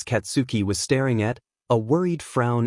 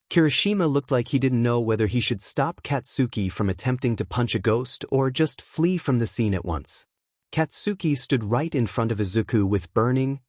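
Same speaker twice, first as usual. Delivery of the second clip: plain reading voice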